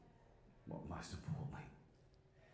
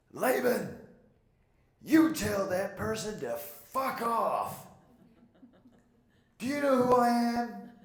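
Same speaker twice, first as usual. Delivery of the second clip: high, loud, slow mock-commanding boom; booming Steve impression; 12 semitones higher, 9 dB louder, slower than usual. Steve telling Laban to ignore the critic, booming. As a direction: belt it out like a brash, supremely sure coach. Steve's blunt reply retold.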